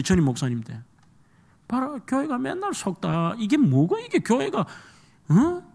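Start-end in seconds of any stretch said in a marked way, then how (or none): 1.7-5.6 higher-pitched imitation of a grumbling deacon. Pastor imitating a rough-spoken deacon grumbling about the church, higher pitched. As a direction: mimic a gruff deacon complaining. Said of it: "이래 하더라고요".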